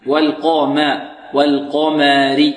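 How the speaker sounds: "والقمر" slow, thick qaf then thin "ma"; light mim. Shown as a demonstration. Teacher models it for students, light mim in "والقمر". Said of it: "والميم مرققة".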